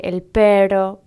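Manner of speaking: "pero" for perro, a single tap instead of a trill; untrilled R. Retold or imitated. Imitating children who find erre difícil, "pero" without the trill. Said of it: "Generalmente dicen R".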